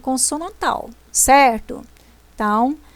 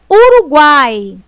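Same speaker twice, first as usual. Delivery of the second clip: "Uruguai" louder, leaning on the A with a weak U and I; stressed A shown; 6 dB louder, slower than usual. Shown as a demonstration. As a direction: hit the A of "Uruguai" hard for the class. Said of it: "falei o A com mais força". ("Uruguai" com o A bem forte)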